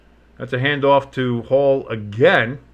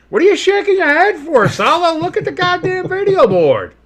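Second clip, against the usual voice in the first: very high, loud squawk of outrage; exasperated yelling; 6 dB louder than usual. Real, not acted. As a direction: yell at the coach in disbelief. Yelling at coach disputing penalty.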